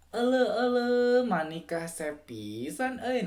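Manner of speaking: rolling Sundanese accent, high loud opening, drawn-out "pisan"; Sundanese lilt. Shown as a demonstration. Giving viewers a second Sundanese example, wavy sing-song Sundanese accent. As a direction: let the Sundanese melody rise and fall. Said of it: "tone-nya itu naik turun".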